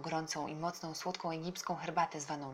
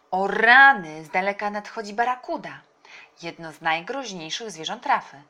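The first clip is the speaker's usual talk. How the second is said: loud burst, easing off; startled exclamation; 3 semitones higher, 12 dB louder than usual. A narrator's reading. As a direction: exclaim at a sudden dangerous sight. Uncle spots a dangerous barracuda. (głośny okrzyk zaskoczenia)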